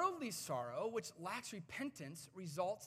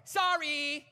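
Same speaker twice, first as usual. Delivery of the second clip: high, loud sulky yell of the word; bratty shouted sorry; 7 semitones higher, 13 dB louder than usual. Retold or imitated. Six-year-old Micah yelling a forced sorry at dad. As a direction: fling the apology back grudgingly.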